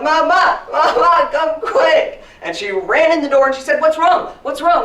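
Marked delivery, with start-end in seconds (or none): 0.0-2.2 put-on high, loud child calling for mama; panicked yell. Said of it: "And I yelled". 3.8-4.8 high, loud urgent questioning; alarmed mother. Acted. Acts mother rushing in alarmed.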